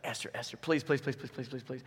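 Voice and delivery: quiet, rapid-repeated mock pleading; hammed-up hushed begging; begging on knees. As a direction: beg under your voice, word tumbling after word. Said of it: "get on his knees before Esther".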